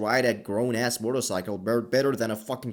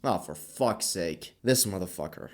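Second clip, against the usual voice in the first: lower, slower, quieter annoyed groan; fed-up mutter; 6 semitones lower, 6 dB quieter than usual. Retold or imitated. Takashi fed up as Ri gets captured, low slow grumble. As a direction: grumble like you can't believe this again. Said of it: "Takashi is like".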